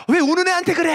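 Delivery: a high, mock-indignant girl's retort; indignant friend. Friend scolds the pastor for upsetting a crying girl, mock indignant. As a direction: squeal it back in defence of her crying friend.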